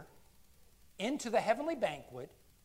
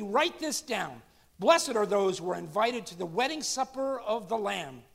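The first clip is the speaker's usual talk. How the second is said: scripture reading at full force; 4 semitones higher, 9 dB louder than usual. Acted. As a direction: announce it to the whole hall. Reads Revelation aloud to church.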